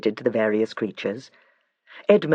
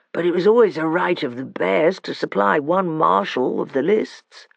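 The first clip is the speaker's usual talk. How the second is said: sleepy bear voice; 5 semitones higher than usual. A narrator's reading. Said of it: "a thick, sleepy voice".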